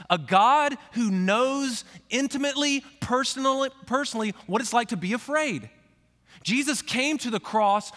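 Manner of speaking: loud, high impassioned preaching; preaching at full force. Acted. Pastor driving home his point to the congregation, impassioned preaching.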